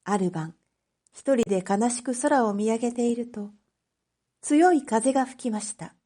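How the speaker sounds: plain reading voice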